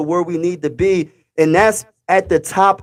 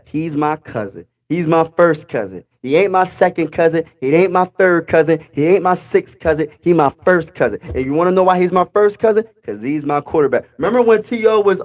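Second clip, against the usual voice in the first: normal talking voice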